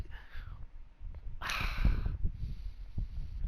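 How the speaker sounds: long, quiet exhale; drawn-out breathy sigh; exasperated sigh. Real, not acted. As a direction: let out a long frustrated sigh. Frustrated at the game ignoring accents.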